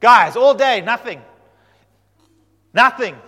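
loud, high mocking exclamation; exasperated call-out. Elijah calling out to the prophets of Baal, loud and scornful. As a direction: shout it at the failed prophets.

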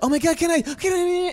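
high, eager fan voice; excited fan. Retold or imitated. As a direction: gush like a starstruck fan. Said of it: "people are".

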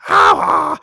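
short high yelp like Toad; quick high-pitched Toad cry. Retold or imitated. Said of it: "Usually he just goes".

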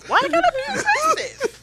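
high squealing mock whine with a laugh. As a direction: mimic them in a squeaky laughing voice. Mocking the men's aggressive label.

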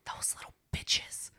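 whispered insult. Real, not acted. Muttered insult.

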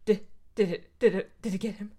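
ordinary talk

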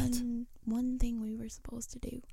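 very soft, breath-only whisper; hushed conspiratorial whisper. Pretend. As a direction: whisper it confidentially to your co-host. Secretive whispered reminder to co-host.

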